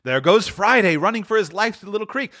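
high, eager storytelling; breathless-with-delight reminiscing; excited Scrooge. A narrator's reading.

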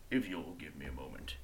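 a low, quiet, polite orc voice; orc servant. Acted.

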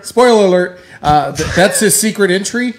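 loud teasing spoiler alert; excited. Real, not acted. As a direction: shout it out as a playful tease. Loud joking tease.

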